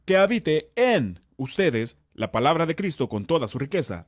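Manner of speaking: "en" punched and isolated by pauses; stop, hit "en" hard, then carry on; stress on en. Shown as a demonstration. Teacher stressing that it lives inside, stress on "en". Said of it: "haciendo hincapié en una palabra diferente".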